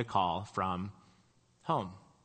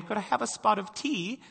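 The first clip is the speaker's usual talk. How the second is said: put-on small, high-pitched child putting on British English; little girl's dainty British tea request, imitated; toddler's British accent; 9 semitones higher than usual. Two-year-old daughter saying it round the Scottish flat, imitated British accent. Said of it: "my daughter Anna, who was about two ... walking around our flat and saying".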